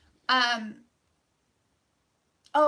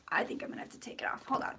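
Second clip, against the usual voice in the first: fast whisper; 2 semitones lower, 8 dB quieter, much faster than usual. Real, not acted. Fast whisper while fixing camera.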